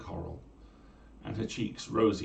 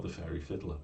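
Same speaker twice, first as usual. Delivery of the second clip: usual speaking voice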